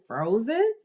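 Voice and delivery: "Frozen?" sliding up high at the end; rising, interested "Frozen?"; questioning echo. Shown as a demonstration. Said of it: "phrase it and look like it's a question".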